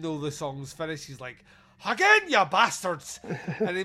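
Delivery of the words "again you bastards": bellowed order at the choir, much louder; angry verger roaring at the choir. Acted. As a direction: yell it at the singers, higher and loud.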